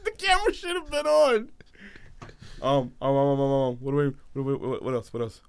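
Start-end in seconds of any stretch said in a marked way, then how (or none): 0.0-1.5 high-pitched, helpless laughing voice; laughing hard. Real, not acted. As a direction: squeeze the words out through laughter. Breaking up laughing mid-joke.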